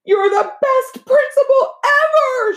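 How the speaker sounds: high, slow, loud sucking-up call; Joy calling out to the principal, high and eager. A narrator's reading. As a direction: flatter the principal to get picked. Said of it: "she called out".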